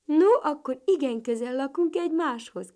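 high, loud and delighted; joyful cry. A narrator's reading. Ladybird Béni to his new neighbour, joyful cry. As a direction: call it out overjoyed. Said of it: "kiáltott örvendezve Katica Béni".

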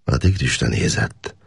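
ordinary delivery

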